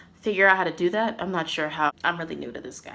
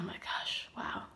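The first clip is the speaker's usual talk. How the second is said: softer, slow breathy whisper; whispered disbelief; 21 dB quieter than usual. Real, not acted. Disbelief at the bad tip.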